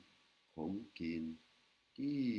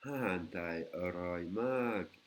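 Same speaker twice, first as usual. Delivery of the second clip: slow Thai demo; 5 dB louder, much slower than usual. Shown as a demonstration. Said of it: "la nourriture thaï est délicieuse".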